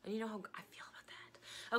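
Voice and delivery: soft unvoiced whisper; a whispered aside about bad grammar. Real, not acted. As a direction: whisper it like a confession.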